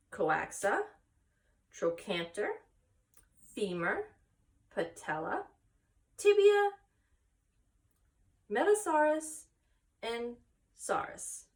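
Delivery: each name spaced out slowly; slow repeat. Acted. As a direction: say them again clearly for Wilbur. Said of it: "Say those names again".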